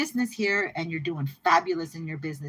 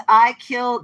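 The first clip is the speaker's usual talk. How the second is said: normal talking voice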